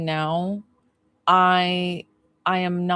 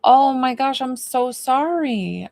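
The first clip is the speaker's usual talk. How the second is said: slow, tender apology; sincere sympathy; 6 semitones higher, 4 dB louder, slower than usual. Real, not acted. Medium consoling a viewer over her brother's death, tender. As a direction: let the sorrow slow you right down.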